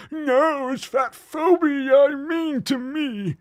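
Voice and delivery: very high, slow, hammed-up whine; mock wailing patient. Mock patient refusing the doctor's advice, wailing. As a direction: bawl in protest like a caricatured patient.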